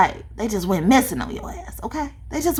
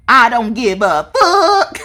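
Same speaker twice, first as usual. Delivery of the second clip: Bernie Mac attitude; 4 semitones higher, 7 dB louder, slower than usual. Retold or imitated. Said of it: "Bernie Mac did, too".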